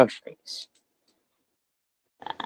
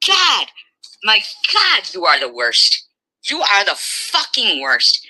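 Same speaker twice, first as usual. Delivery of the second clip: furious yelling; 7 semitones higher than usual. Real, not acted. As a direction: shout it in disgust. Daughter yelling at her mother.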